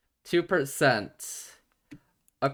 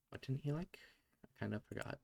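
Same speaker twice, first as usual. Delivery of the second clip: quiet aside; 17 dB quieter, faster than usual. Real, not acted.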